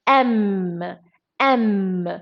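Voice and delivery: "M" as low, drawn-out "em", repeated; vowel-sound demo. Shown as a demonstration. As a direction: open "M" on e, hold the hum. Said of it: "a sound".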